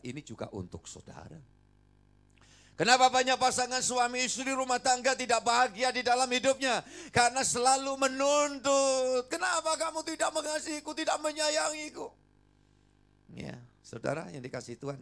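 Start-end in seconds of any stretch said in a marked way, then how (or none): 2.8-12.1 much higher, louder impassioned preaching; preaching at full force. Acted. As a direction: cry out the question to the congregation. Preaching peak over music.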